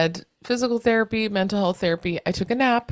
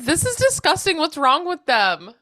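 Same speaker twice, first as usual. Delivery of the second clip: high-pitched imitation of appalled disgust; mock disgusted outburst; 9 semitones higher than usual. Coworker tasting a mozzarella stick, imitated in high disgust. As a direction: act out her revolted reaction, pitched way up.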